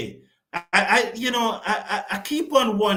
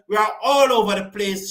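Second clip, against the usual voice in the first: ordinary delivery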